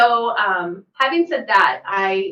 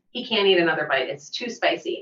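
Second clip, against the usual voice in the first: ordinary talk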